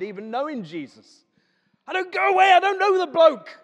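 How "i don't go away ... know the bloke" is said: high, loud frightened protest; panicked denial. Acted. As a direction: shout it off as a scared Peter. Peter denying Jesus.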